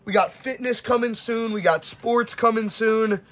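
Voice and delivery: high-pitched promo hype; hype sign-off. Real, not acted. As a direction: hype up what's coming next. Hyping upcoming content at sign-off.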